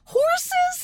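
squeaky shocked question; surprised. Real, not acted. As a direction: shriek it in disbelief. Shocked echo of horses.

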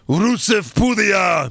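slow, drawn-out entrance call; mock announcer shout. Host announcing Rusev's surprise entrance, drawn-out mock announcer call. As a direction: stretch the name like a ring announcer.

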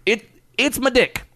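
a hint of Cosby; lightly voiced Cosby cadence. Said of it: "I said that kinda like Cosby".

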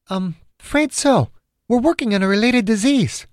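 high-pitched heroine's reply; woman character's voice. Acted. Margaret explains her work to Chang, high woman's voice. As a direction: pitch it high, as a female caller. Acted heroine answers on phone.

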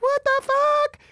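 high, loud, stunned what the fuck; shocked outrage. Retold or imitated. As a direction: yelps it in disbelief. Shocked at being choked.

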